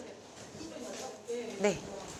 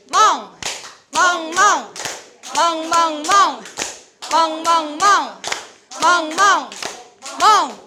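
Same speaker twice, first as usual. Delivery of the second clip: loud, higher rhythmic barking; shouted barks; 5 semitones higher, 8 dB louder, much slower than usual. Shown as a demonstration. Teacher shouting barks with the class, loud and high. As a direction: bark out each clap with energy. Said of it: "멍으로 외치시면 돼요".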